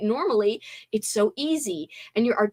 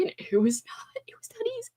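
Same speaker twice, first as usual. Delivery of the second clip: whispered, much softer; 12 semitones higher, 7 dB quieter, faster than usual. Real, not acted. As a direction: confess it under your breath. Admitting exhaustion, dropped voice.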